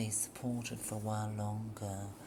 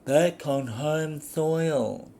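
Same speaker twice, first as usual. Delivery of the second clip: slow, distant, louder reading; remote tone; 4 semitones higher, 12 dB louder, slower than usual. Acted. She responds to him in a remote tone. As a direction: say it coolly as if far away. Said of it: "she responded in a remote tone".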